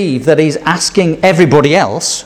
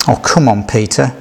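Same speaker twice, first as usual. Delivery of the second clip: ordinary talk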